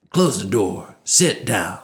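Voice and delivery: lightly voiced gruff news director; brusque orders with a touch of gruffness; gruff boss. News director orders a producer into his office, lightly gruff. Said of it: "Mister Gruff".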